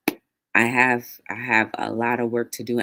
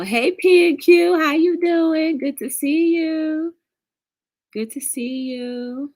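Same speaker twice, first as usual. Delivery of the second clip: excited greeting; 13 semitones higher, 6 dB louder, slower than usual. Real, not acted. Greeting a viewer by name.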